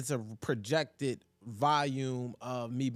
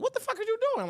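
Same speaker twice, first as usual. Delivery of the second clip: baffled outburst; 3 dB louder, faster than usual. Retold or imitated. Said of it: "just be like".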